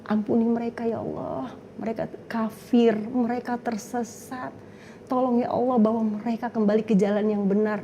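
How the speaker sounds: hushed intercession; soft prayer. Retold or imitated. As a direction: pray softly for the lost. Retold prayer for others.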